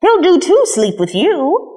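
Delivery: a hint of a brisk nanny, slow then high; lightly voiced Nana. A narrator's reading. Nana gives the boy the rabbit at bedtime, lightly voiced. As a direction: hand the bunny over offhand, hurried. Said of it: "she said".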